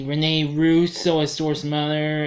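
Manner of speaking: usual speaking voice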